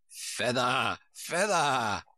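drawn-out repeated call; mild cry from a bossy sheep. A narrator's reading. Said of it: "the sheep cried again".

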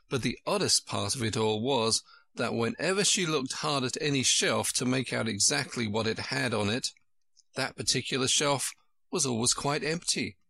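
usual speaking voice

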